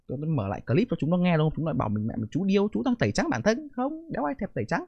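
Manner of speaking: ordinary delivery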